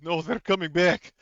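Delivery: high, loud cry of dread; horrified. Acted. Game master as Drubov hearing the creature return, horrified. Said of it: "gets even more pale with horror".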